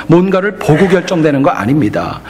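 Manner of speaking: ordinary talk, nothing marked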